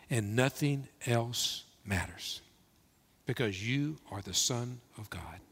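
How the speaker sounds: soft, slow words with long pauses; quiet, awed confession of faith; hushed reverent declaration. Real, not acted. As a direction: let each phrase settle, sinking toward a whisper. Reverent declaration closing scripture reading.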